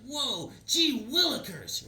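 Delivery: put-on high, slow, gee-whiz boy voice; wide-eyed kid. The boy marvels at the cowboy's gift in a high, slow gee-whiz voice. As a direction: play it like an old-fashioned comic kid.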